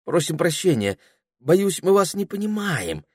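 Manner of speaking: high-pitched, puzzled apology; bewildered. A narrator's reading. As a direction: apologise in confusion. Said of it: "проговорила в недоумении Пенелопа".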